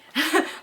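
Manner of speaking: quick giggle; real laugh at own joke; short high laugh. Real, not acted. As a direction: let a giggle slip. Laughs after impression.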